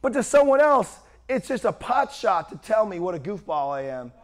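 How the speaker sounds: normal talking voice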